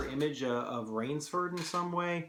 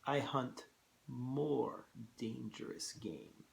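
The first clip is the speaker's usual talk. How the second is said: put-on slow, hushed Russian-accented reveal; ominously slow Russian-accented general; 7 dB quieter, slower than usual. A narrator's reading. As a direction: draw it out slowly and softly. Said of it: "in the same slow tone".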